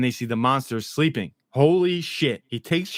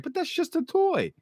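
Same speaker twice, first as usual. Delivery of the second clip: much higher put-on boy voice for Henry; lightly cartoonish boy voice, much higher; imitating Henry. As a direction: pitch way up to play the kid. Voicing Henry in recap.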